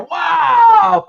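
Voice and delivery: high excited exclamation; surprised wow. Real, not acted. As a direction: shout out in happy surprise. Surprised as a viewer joins.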